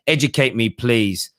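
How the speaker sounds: usual speaking voice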